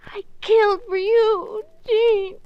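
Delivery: anguished confession. Acted. Wife confessing murders to husband.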